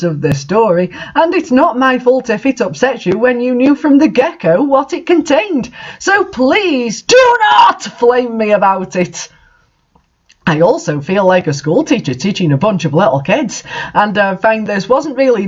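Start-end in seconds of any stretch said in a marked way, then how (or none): 6.0-9.3 emphatic plea. Real, not acted. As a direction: hammer each word home. Author pleading with flaming reviewers.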